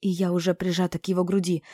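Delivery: ordinary delivery, nothing marked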